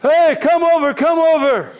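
high, urgent calling out, re-enacted; retold shout. Shouting to a guard for help, re-enacted urgent call. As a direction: call someone over from a distance. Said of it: "So I shouted at the guard".

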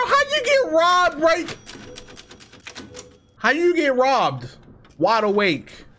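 loud, high-pitched mock indignation; hammed-up outrage. Gamer mocking himself over a stolen gun, loud hammed-up outrage. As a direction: yell it in exaggerated disbelief, stretching the words.

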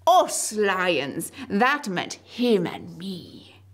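excited proud lion. Acted. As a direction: boast to everyone, fast.

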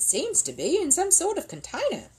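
high-pitched puzzled exclamation; exclaiming. Acted. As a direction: exclaim over a baffling find. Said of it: "he exclaimed".

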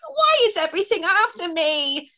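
high, pleading fairy voice; despairing cry. Acted. Fleeing fairy Twigs cries out in despair. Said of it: "she cried with despair".

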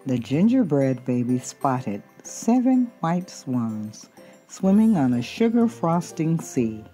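usual speaking voice

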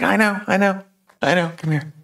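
high-pitched, happy reassurance; giddy. Acted. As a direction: brush off the apology, too delighted to listen. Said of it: "giddy right now".

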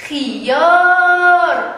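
خيار with a loud, high, long-held aa; almost chant خيار, sustaining the aa; long vowel. Shown as a demonstration. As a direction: stretch the aa in خيار loudly. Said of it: "بالألف".

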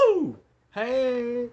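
loud high woo then hey; high whoop. Acted. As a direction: cheer it like a jingle. Intro song retry.

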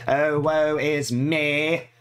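loud, very slow hammed-up lament; melodramatic woe. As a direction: stretch it out like a stage tragedy.

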